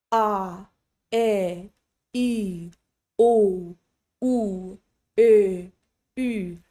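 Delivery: A, E, I, O, U each one steady sound; separate, clean vowels with no glide; crisp pure vowels. Shown as a demonstration. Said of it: "very crisp".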